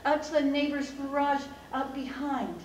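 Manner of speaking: usual speaking voice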